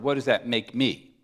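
ordinary talk

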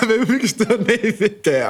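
slow, high, through laughter; laughing. Real, not acted. Cohost pitches the name to his friend, laughing. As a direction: giggle out the suggestion. Joking about podcast name.

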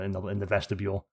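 ordinary talk, nothing marked

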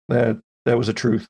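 usual speaking voice

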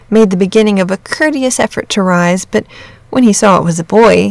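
plain reading voice

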